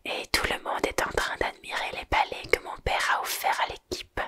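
ordinary delivery

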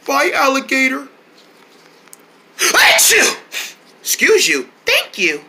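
normal talking voice

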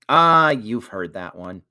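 slow, loud "Ah" of letdown; drawn-out disappointed reaction. A narrator's reading. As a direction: groan it out, let down. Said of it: "sounding disappointed".

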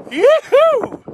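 high sing-song yoo-hoo call. Real, not acted. Fish caught, celebrating.

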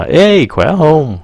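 high, slow, easy greeting between friends; casual greeting. Shown as a demonstration. Teacher shows a casual Vietnamese greeting, high and slow. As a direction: stretch it out like hailing a buddy. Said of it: "Mà".

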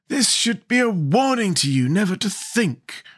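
high-pitched, severe admonition. A narrator's reading. Scarecrow warns foolish Jack, severely. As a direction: lecture sternly in a high voice. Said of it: "returned the Scarecrow severely".

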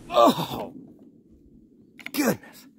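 startled high oh goodness; surprised. Real, not acted. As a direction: caught off guard by the recoil. Surprised by the gun's kick.

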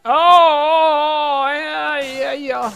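high, loud joyful cry; ecstatic cheering. Real, not acted. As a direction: cheer like you just won.

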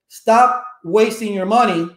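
usual speaking voice

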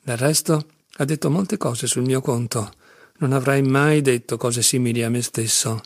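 ordinary delivery

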